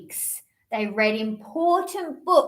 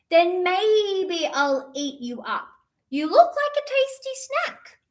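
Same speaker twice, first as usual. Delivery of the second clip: high teasing kitten voice; playful kitten threatening its snack; hungry kitten; 7 semitones higher than usual. Acted. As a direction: tease the bird like a hungry cat.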